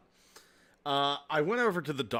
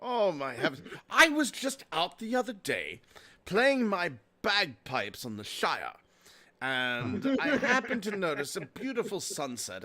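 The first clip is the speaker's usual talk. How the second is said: lilting, hammed-up British accent; put-on British accent. Man telling customers about bagpipes and ale, put-on British accent. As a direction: rhapsodize in a mock British accent. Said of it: "I noticed he had an accent".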